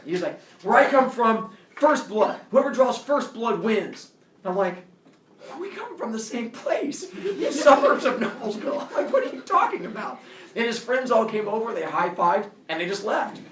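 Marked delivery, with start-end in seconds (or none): 0.6-4.1 mock tough declaration. Bully declares his fight rule. 5.6-10.2 high-pitched, exasperated rebuttal; incredulous protest. Retold or imitated. Kid to bully from the same suburb, high incredulous protest.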